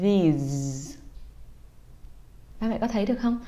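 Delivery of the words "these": "These" with tongue between teeth, buzzing; "These" drawn out, tongue and lips vibrating; voiced th. Shown as a demonstration. Teacher shows parents the buzzing th in "These". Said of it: "đẩy cái hơi ra ... rung cả lưỡi".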